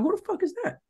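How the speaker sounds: high-pitched mock disbelief; retold incredulous question. Seeing a girl on FaceTime, retold incredulous question. Retells his shock on FaceTime.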